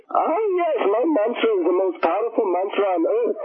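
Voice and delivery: high, proud guru voice, retold.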